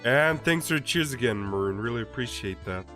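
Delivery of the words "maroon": plain reading voice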